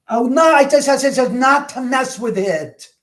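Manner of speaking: high, loud exasperated wail; exasperated protest. Real, not acted. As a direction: cry out in high-pitched frustration. Protests after spirits mess with it.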